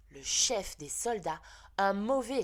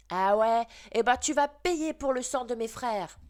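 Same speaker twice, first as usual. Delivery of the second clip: put-on higher and louder, a boy yelling back; shouted threat; 5 semitones higher, 7 dB louder than usual. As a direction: yell the threat mid-fight. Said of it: "Il a crié".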